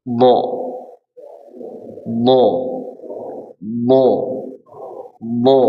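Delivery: crisp isolated "Bo" syllables; sounding the letter. Shown as a demonstration. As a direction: say "Bo" slowly, each one alone.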